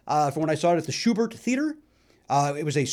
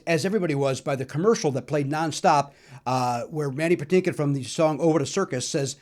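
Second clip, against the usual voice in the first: ordinary talk, nothing marked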